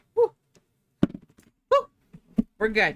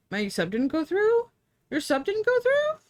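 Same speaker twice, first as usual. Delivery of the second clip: high disbelieving question; rising shocked question; surprised; 13 semitones higher than usual. Real, not acted. Reading chat, surprised.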